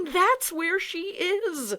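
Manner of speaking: high, loud and drawn out; excited shout. Acted. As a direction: call out, sure of it. Tom still yelling, pointing.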